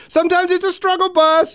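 high, chipper smoothing-over voice, imitating Shelly; brightly paper over the awkwardness; chirpy reassurance. Shelly covering for him as doors close, high chipper reassurance.

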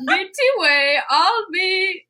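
sing-song mock-Swedish; Swedish Chef accent. Reading fan fiction through laughter, hammed-up Swedish accent. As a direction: read the misspellings in a bad Swedish accent. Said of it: "in the accent of Swedish Chef".

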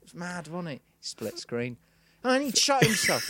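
mock stand-up patter, mostly high-pitched; observational comic. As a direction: send up an amateur's observational routine. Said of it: "trying to do observational comedy".

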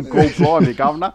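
laughing mock Cockney, "God blimey, governor"; hammed-up Cockney cabbie through laughter; Cockney accent. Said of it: "he's gone".

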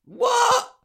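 high, loud shocked yelp; shocked what. Real, not acted. Friend hears of ten days without signal, shocked yelp. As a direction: blurt it out in disbelief.

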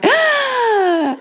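high drawn-out excited "ah"; imitated baby's excited squeal. Comedian imitates her baby thrilled by the story, excited squeal.

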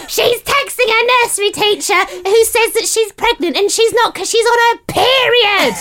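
high, piercing mock yell of the accusation; imitating a friend screaming the secret. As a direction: shriek it out for twenty strangers to hear. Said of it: "decided to scream at the top of her voice".

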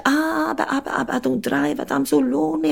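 high, plaintive mock excuses; lonely neighbour. Neighbour objecting to visiting her sister, high mock lament. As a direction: imitate her moaning protest, pitched well up.

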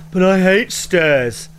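slow, drawn-out whine; mock-sulky whine about the stairs; whiny complaint. Character whining about stairs.

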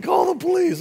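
high, loud panicked yell; shouting. Retold or imitated. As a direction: scream for help from the floor. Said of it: "and the guy said".

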